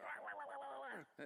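high, muffled wah-wah trombone talk; high wah-wah noise standing in for speech; Charlie Brown adult voice. Retold or imitated. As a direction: mimic the garbled teacher voice from Peanuts. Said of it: "it's a Charlie Brown, like".

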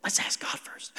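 whispered, hushed advice; priest's whisper. Retold or imitated. Priest quietly counsels the king, whispering. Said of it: "But the priest said".

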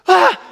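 short, high startled yell; sudden loud cry on waking; startled scream. Retold or imitated. Driver jolted awake at the wheel, startled scream. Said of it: "screaming at him".

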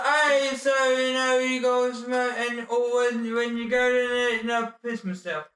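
loud, slurred mock rock-star ramble. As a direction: ham up a rambling, mumbling rocker at the pub. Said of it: "talked like [name]".

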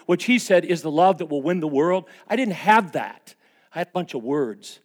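loud, high fervent preaching; preaching at full force. Acted. Pastor confessing his anger to the church, loud fervent preaching. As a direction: drive it home to the congregation.

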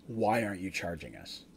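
low, hushed, deadpan demand; dead serious. Acted. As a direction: ask it low and quiet, in dead earnest. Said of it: "With just a dead serious face".

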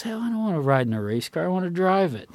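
mock protest, quick and rising high; Tom Cruise. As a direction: object eagerly, acting out the complaint.

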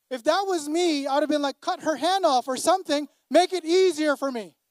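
pleading in mock panic through labor; mock panicked plea. Pastor imagining himself giving birth, mock panicked.

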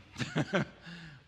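loud, high burst of laughter; real amused laughter; hearty laugh. Real, not acted. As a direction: laugh out at your own punchline. Laughs at own retold comeback.

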